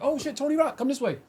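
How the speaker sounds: light up at the famous face; surprised recognition. Retold or imitated. Bartender recognizes celebrity.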